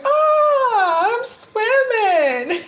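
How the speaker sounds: very high, slow, delighted squeal; gleeful swimmer. Pretend. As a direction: float happily and show off. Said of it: "you in the deep end going".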